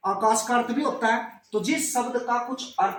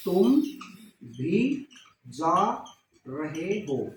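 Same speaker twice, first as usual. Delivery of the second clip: word by word; 5 semitones lower, much slower than usual. Shown as a demonstration. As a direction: spell the sentence out one word at a time. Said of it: "तो इसमें निपात बताओ". (एक एक शब्द अलग करके, धीरे धीरे नीचे)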